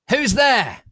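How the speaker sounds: very loud, high yell; angry shout. A narrator's reading. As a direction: bark it into the dark. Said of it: "he shouted angrily".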